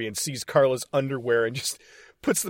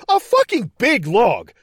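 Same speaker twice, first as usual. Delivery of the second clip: big log, pushed up high and loud; excited outburst; 7 semitones higher, 4 dB louder than usual. Real, not acted. To co-host about the log, excited shout. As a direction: marvel at it, louder and higher.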